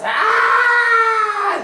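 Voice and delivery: a very high, loud monster shriek; monster roar. Acted. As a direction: let the beast scream as it charges. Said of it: "takes his horn and goes".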